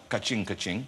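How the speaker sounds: playful put-on street voice on "ka-ching"; mock street accent. Host jokes about money in slang, mock street accent. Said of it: "What is his accent?".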